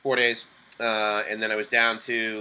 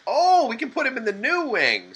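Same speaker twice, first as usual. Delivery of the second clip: much higher bright mock voice; imitating the clerk; 13 semitones higher than usual. Clerk changing her mind about a room, mock high voice. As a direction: play the suddenly helpful clerk. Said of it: "and she says".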